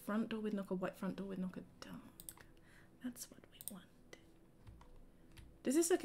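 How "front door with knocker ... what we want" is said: soft whispered musing; whispering while browsing front doors. Real, not acted. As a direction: whisper the choice to yourself. Muttering while choosing door.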